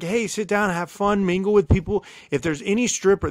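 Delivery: Kendra's welcome. Retold or imitated. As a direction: play the generous host selling the party.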